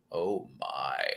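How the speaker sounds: very low, soft murmur; hushed reaction. Retold or imitated. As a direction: drop low and soft, as if quietly awed. Reaction acted out in retold story.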